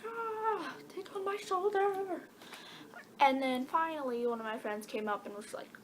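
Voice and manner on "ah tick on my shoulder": high hammed-up panicked squeal; mock scream. Retelling freaking out over a tick, high mock panicked squeal. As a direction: squeal it like the bug is still there.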